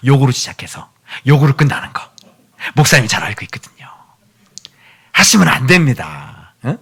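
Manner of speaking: ordinary talk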